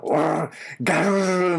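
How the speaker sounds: playful rolled-r growl like a puppy; dog growl. Retold or imitated. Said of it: "ワンちゃんがさ".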